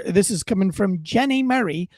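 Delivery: ordinary talk, nothing marked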